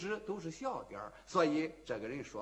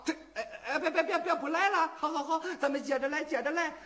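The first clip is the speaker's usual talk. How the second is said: nervous laughing plea; 9 semitones higher, faster than usual. Acted. Gambler begs Wu Song stay.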